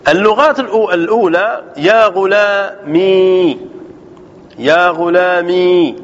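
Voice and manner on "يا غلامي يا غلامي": يا غلامي twice, each with a long held "mee"; slow, stretched "ya ghulamee" for يا غلامي; kasra, silent ya. Shown as a demonstration. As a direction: draw out the final ee of يا غلامي. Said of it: "بكسر الميم".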